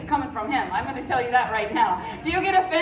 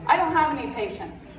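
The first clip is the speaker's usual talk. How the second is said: ordinary talk, nothing marked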